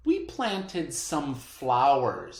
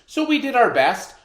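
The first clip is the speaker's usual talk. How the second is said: quick, louder, higher admission; a bit sheepish; 10 semitones higher, 9 dB louder, faster than usual. Real, not acted. Teacher to class, quick sheepish aside. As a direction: laugh off the struggle. Teacher admits struggling with Chinese.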